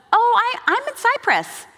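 husband breezily explaining from Cyprus, very high; casual husband. Retold or imitated. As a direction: toss it off lightly. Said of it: "He goes".

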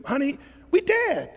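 high, laughing imitation of a carefree driver; high, amused voice of a man at peace; mimicking the driver. Pastor imitating a YouTube driver telling his wife.

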